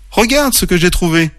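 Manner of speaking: high, eager exclamation; exclaiming. A narrator's reading. Oreille shows friends her stone, exclaiming. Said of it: "s'exclama Oreille".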